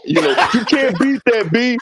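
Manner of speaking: high, fast-talking hype man; hyped rapper's plea. Acted. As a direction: warn him urgently in a high voice. Acted pitch to rapper Cam.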